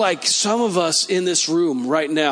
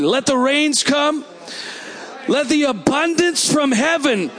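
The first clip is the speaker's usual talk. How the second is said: higher, slow, declaring prayer; fervent prayer; 9 semitones higher than usual. Acted. Preacher praying over the congregation, higher fervent declaring prayer. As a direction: cry out to heaven for rain, stretching the words.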